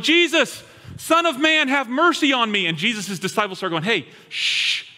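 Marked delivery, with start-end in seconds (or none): 0.0-2.7 high, loud plea to be heard; blind man calling out. Retold or imitated. Blind man on the road calls to passing Jesus, loud plea. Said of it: "starts going". 3.8-4.8 unvoiced shushing; hushing whisper. Disciples hush the blind man during teaching, whispered shush.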